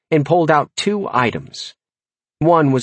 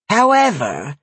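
put-on old witch voice; 8 semitones higher than usual. Old dwarf witch speaking.

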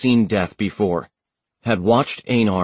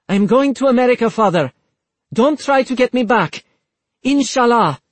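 high, loud defiant farewell; defiant daughter; 13 semitones higher, 4 dB louder than usual. A narrator's reading. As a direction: bold and defiant. Rabia defies her father.

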